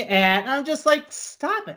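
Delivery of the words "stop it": high, soft, exasperated squeal; mock pleading. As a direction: beg the mopey singer in a small high voice. Acted plea to mopey singer.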